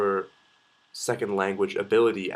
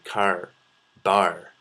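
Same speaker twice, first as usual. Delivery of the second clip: Nova Scotia R's in CAR and BAR; Nova Scotia accent; 4 dB louder than usual. Shown as a demonstration. Showing how Nova Scotians say car, bar, Nova Scotia accent. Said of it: "R's are really Canadian".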